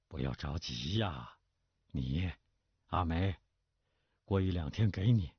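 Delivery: lightly voiced placating father; mildly forced good humour. A narrator's reading. Father putting off his daughter, mildly forced reassurance. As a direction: soothe her, a bit reluctantly. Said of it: "冯云清勉强笑了一笑说".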